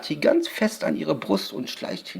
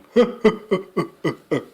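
witch's chuckle in short pulses; crooked witch laugh; 3 semitones higher than usual. A narrator's reading. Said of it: "zu einem schiefen Lachen".

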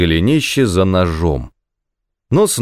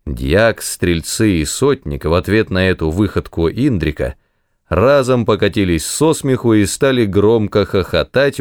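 plain reading voice

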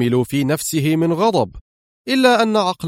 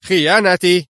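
a sharp, high, louder indignant cry; angry outburst; 6 semitones higher, 4 dB louder than usual. A narrator's reading. Gomani to his lawyer, indignant at the accusation: angry higher cry. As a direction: bristle at the word as an insult. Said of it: "هتف جوماني في غضب".

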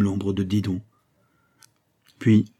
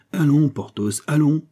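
higher, louder urging; cried out; 6 semitones higher, 3 dB louder than usual. A narrator's reading. Aramis shaking Porthos awake, louder and higher insistent call. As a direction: rouse him, insistent. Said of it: "cria-t-il".

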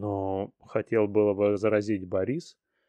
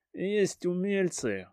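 said through teeth; 9 semitones higher, slower than usual. Acted. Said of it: "процедил меняла".

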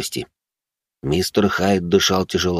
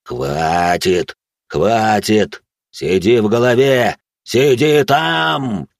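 drawn-out growl rising to a shout; tormented command; 2 semitones higher, 3 dB louder, much slower than usual. Acted. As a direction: order the inner voice down, building in force. Monster fights voice in his head.

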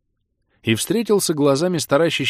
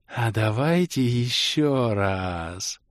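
very slow hopeful plea; much slower than usual. A narrator's reading. Bald elf asking the leader to fly again, hopeful. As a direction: ask slowly, full of hope. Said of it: "с надеждой".

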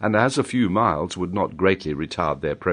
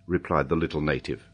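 usual speaking voice

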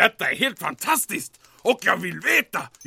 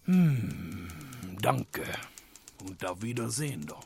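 fading mumble; 10 semitones lower, 10 dB quieter than usual. Acted. Commandant leaving, defeated.

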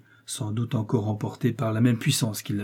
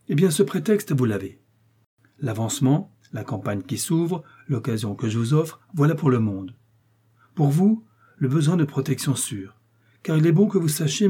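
raised, louder voice of a commanding cardinal; authoritative cardinal voice, pitched up; 5 semitones higher, 5 dB louder than usual. Acted. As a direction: speak down to the young man as the cardinal. Cardinal continues speaking.

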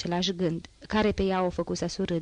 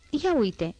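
higher-pitched exclamation; exclaiming; 6 semitones higher than usual. A narrator's reading. Girl spotting things in Marius's room, exclaiming. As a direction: exclaim it on a raised note. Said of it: "exclamă ea".

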